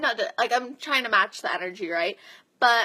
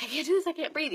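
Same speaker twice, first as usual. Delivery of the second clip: mock protest in a raised voice; retold panicky protest; 7 semitones higher, faster than usual. Runner protesting to the coach mid-run, retold panicky protest. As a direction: imitate a struggling runner, higher pitched.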